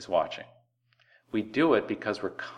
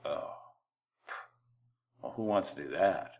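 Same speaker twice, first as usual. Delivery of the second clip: mock grumbling; 5 semitones lower, 11 dB quieter than usual. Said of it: "And you go".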